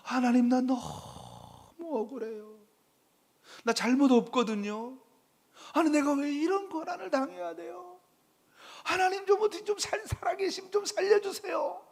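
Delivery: high, pleading cry to God; desperate plea. Acted. Suffering believer begging a silent God, high pleading. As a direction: beg in a rising, strained voice.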